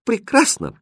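high, delighted exclamation; exclaiming. A narrator's reading. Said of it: "воскликнул Хрустальт". (радостное восклицание)

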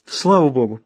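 ordinary delivery

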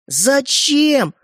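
high, drawn-out outcry of disbelief; shocked demand. Acted. Brothers asking why he gave it away, shocked.

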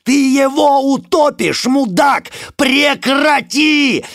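high, loud, slow furious scream; yelling. Acted. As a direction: scream at him to let go. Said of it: "а я орал". (яростный крик)

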